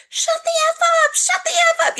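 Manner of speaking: screamed high and loud; imagined yelling. Pretend. Imagining yelling at the retreat teacher, screamed. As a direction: let the rage burst out in a scream. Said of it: "starts yelling".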